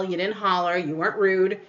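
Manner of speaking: usual speaking voice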